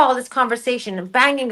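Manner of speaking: plain reading voice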